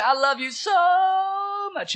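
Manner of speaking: put-on very high child voice, hugely stretched "so"; high, slow, exaggerated little-girl impression; imitated little daughter. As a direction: gush it like a three-year-old. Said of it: "She'll say".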